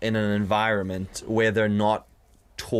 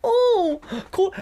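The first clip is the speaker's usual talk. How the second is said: high, slow sneering voice; a rude customer mocking his politeness; mocking posh customer; 5 dB louder, much slower than usual. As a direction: sneer it back like a snooty customer. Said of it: "And they were like".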